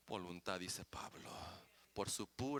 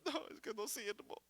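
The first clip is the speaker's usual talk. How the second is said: mock discouraged voice, softer; defeated believer; 9 semitones higher, 7 dB quieter than usual. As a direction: lightly play a self-doubting believer. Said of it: "porque usted comienza a decir". (imitando a un creyente desanimado)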